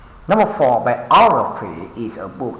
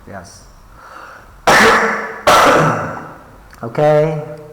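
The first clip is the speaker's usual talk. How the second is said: usual speaking voice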